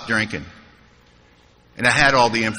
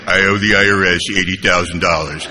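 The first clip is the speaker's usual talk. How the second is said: deadpan confession; 7 semitones lower than usual. Retold or imitated. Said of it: "and I'd go".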